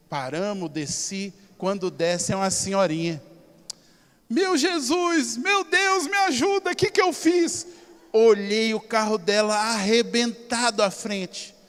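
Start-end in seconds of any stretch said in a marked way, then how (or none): 4.3-7.6 put-on high, loud, slow frantic old lady's cry; panicked old lady. Old lady after hitting his car, crying out higher and louder in panic. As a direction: play the distraught old lady after the crash.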